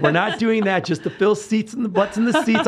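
high, loud imitation of her co-host; imitating co-host. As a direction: mimic a firm, sensible partner. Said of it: "and she's like".

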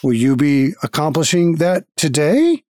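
slow, stiff robotic greeter voice; guild automaton. Acted. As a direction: speak like a polite machine at a desk.

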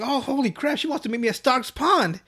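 high-pitched thrilled exclamation; acted excitement. Boy reading his crush's note, acted excitement. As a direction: burst out in giddy delight. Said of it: "so he's all excited".

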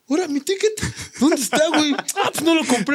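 high, put-on innocent voice pretending to look for a ticket; mock innocent search. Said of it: "Y ya nosotros fingiendo eso".

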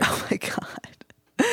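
whispered through a laugh; breathy laugh, whispered. Real, not acted. Laughing at partner's jab.

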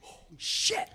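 hushed, voiceless imitation of shock; stunned whisper. Retelling a windsurfer turning to see a whale, stunned whisper. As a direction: breathe it out in mock awe.

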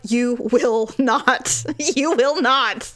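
slow, deliberate "You will not"; wife firmly rebuts husband's claim; firm retort. Retold or imitated. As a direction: lay down the law slowly. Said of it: "I'm like".

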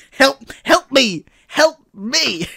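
high, loud, drawn-out cry for help; hammed-up helpless wail; mock plea for help. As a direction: call out slowly in exaggerated distress. Acted plea as Harry, a bit.

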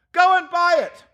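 high, loud, excited order; calling down. Acted. Scrooge sending the boy for turkey, high loud call. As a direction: holler the instruction below.

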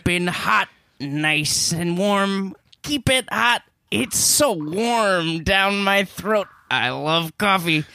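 slow, savouring every word; hammed-up slow coffee lover; slow coffee rhapsody. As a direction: roll it out like tasting hot coffee.

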